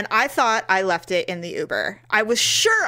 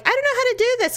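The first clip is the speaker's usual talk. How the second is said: much higher helpless whine; imitating a flustered friend; much faster than usual. Mimicking her friend unable to tip, flustered. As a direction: sound lost with the app. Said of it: "you're like".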